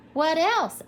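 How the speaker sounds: high, loud eager demand; impatient. A narrator's reading. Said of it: "asked the fish impatiently".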